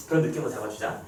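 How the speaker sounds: ordinary delivery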